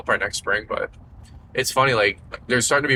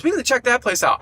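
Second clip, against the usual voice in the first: mocking wife, high; 13 semitones higher than usual. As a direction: mimic her eager insistence, pitched up. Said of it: "And Liz goes".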